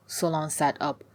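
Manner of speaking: ordinary delivery, nothing marked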